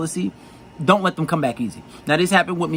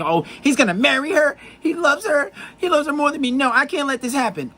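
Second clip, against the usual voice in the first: put-on much higher, panicky girlfriend voice; jealous girlfriend's thoughts; 10 semitones higher than usual. Coach voicing a jealous girl's panic, much higher and anxious. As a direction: squeak it out like a worried girlfriend fearing he'll marry the other. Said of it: "she's gonna think".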